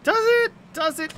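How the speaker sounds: high-pitched mocking question; high squeak. Real, not acted. Mock questioning himself.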